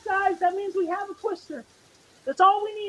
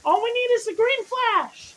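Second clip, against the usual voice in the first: usual speaking voice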